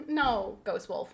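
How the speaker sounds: ordinary delivery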